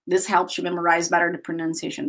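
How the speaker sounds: ordinary talk, nothing marked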